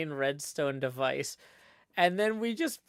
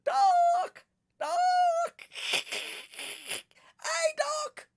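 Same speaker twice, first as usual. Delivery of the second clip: very high yelping calls in a put-on voice; bad Ollie impression; 3 dB louder, much slower than usual. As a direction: call out over and over in a squeaky mock voice. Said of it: "I was doing bad Ollie impressions".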